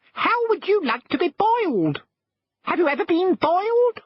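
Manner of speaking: very high, tiny squeaky shrimp voice, indignant; shrimps' voice. Acted. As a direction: squeak it out like offended little shrimps. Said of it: "But they only said".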